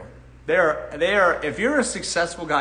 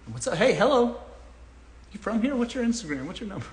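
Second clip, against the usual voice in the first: imitating forward girls; 4 semitones higher, 6 dB quieter than usual. Acting out girls approaching him.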